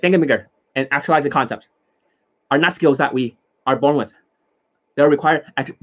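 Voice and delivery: fast, words run together with no gaps; words bunched together. Shown as a demonstration. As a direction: rush it all into one stream. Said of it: "They bunch all the words together".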